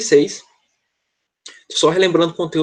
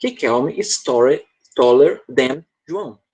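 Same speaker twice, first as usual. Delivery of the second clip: slow model sentence; slower than usual. Shown as a demonstration. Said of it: "Vamos dizer que o Riquelme é mais alto".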